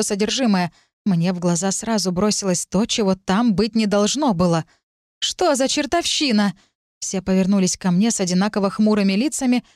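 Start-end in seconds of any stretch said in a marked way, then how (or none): 5.2-6.5 surprised. Acted. Narrator finds something unexpected.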